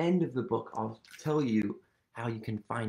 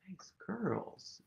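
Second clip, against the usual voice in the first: whisper; 6 semitones higher, 8 dB quieter than usual. Real, not acted. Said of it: "praising kids softly".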